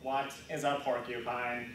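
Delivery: low robotic monotone; canned robot answer, evenly spoken. Acted. Android answers quiz question, robot voice. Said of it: "record them in a robot voice".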